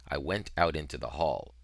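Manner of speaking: ordinary delivery, nothing marked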